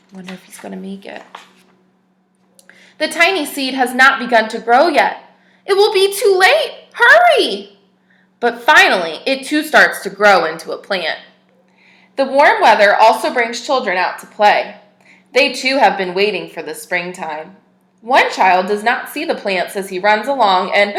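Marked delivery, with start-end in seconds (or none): 3.0-5.2 clear, even storybook reading voice; story narration. Acted. Teacher reads a picture book to children, storybook narration. 5.7-7.8 higher, louder cry of hurry; urgent story voice. Acted. Narrator voices a character urging the seed to hurry, urgent high call. 8.4-20.9 clear, even storybook reading voice; story narration. Acted. Teacher reads a picture book to children, storybook narration.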